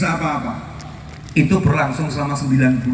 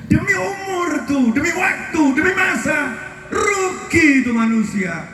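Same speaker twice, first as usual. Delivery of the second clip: high, slow, emphatic preaching; 11 semitones higher, slower than usual. Real, not acted. Preacher translating the verse for listeners, emphatic preaching. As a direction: proclaim each oath in a raised, drawn-out voice.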